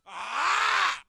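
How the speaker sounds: very loud, rising high "aah"; real loud yell; startled scream. Real, not acted. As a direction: scream in sudden alarm.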